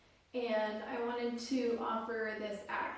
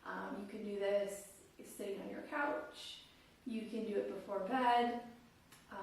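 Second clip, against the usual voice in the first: usual speaking voice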